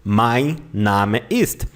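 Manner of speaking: slow, careful German "Mein Name ist", open a in Name; German pronunciation. Shown as a demonstration. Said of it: "You just say".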